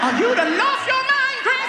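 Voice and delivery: very high, loud, drawn-out disbelief; hammed-up motherly outrage; outraged mother. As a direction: screech it like an appalled mama. Outraged mother responds to backtalk.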